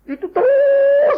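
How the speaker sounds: a ringing, endless "Terus"; long high drawn-out cry. Acted. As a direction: hold "Terus" high and loud like an endless call. Forever goes on and on.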